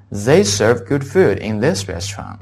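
slow, clearly spaced English model sentence; measured teacher's read-aloud. Shown as a demonstration. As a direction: read it slowly for students to repeat. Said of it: "大家一起跟我读一下".

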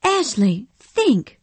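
a gentle wife suddenly stern; almost angry. Acted. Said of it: "face looked almost angry".

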